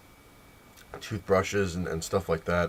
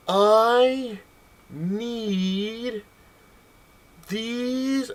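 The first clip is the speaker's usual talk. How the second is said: high, drawn-out word by word; exaggeratedly slow; 13 semitones higher, much slower than usual. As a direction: stretch each word for a lip-reader. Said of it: "can you please do a little slower".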